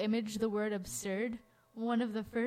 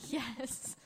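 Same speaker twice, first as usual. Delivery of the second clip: normal talking voice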